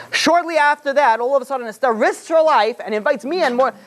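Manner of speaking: high, altered voice for scheming Haman; suspicious Haman. Retold or imitated. Haman puzzling over Esther's invitation, high suspicious voice.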